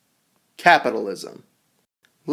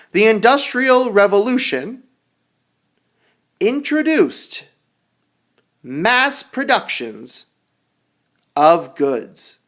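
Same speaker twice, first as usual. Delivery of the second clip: reading aloud to students; 7 semitones higher, 4 dB louder, slower than usual. Acted. Reading sentence to class.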